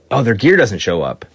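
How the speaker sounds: normal talking voice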